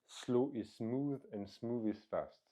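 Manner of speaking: slow, deliberate English with a heavy French accent. Shown as a demonstration. Coach quoting an English saying, slow and heavily French-accented. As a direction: savour each English word in a thick French accent. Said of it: "tu vas voir avec mon magnifique accent".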